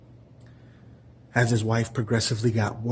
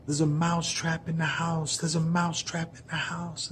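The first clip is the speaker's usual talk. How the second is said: high, frantic squeaky mouse plea; a tiny mouse sounding the alarm; panicked mouse; 7 semitones higher than usual. Acted. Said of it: "was like".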